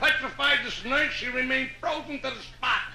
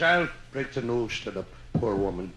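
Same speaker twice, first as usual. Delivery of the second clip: lightly Irish, much lower voice; Irish accent; 4 dB quieter than usual. Acted. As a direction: Mike in a low Irish brogue. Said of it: "I gotta do an Irish story".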